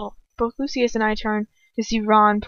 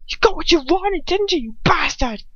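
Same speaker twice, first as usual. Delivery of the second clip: higher, louder accusing scream; 8 semitones higher, 4 dB louder than usual. A narrator's reading. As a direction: hurl the accusation at him. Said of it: "he screams".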